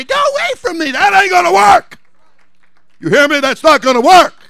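loud, high preacher's warning; full-force preaching. Real, not acted. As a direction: build from firm to shouted rebuke.

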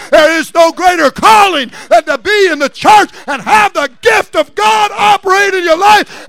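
loud, high preaching shout, building; full-force preaching. Real, not acted. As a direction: build to a driving sermon climax.

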